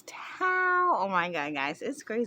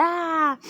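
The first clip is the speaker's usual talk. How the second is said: panicked cry; 10 semitones higher, 8 dB louder than usual. Retold or imitated. Mom panicking over there.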